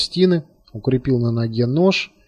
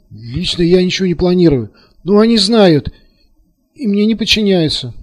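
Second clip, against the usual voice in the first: high, strangled protest; hurried, choked voice; 5 semitones higher, 5 dB louder than usual. A narrator's reading. Terrified colonel excusing himself to Taras, choked. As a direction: blurt it out from a tightened throat. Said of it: "сдавленным голосом заговорил".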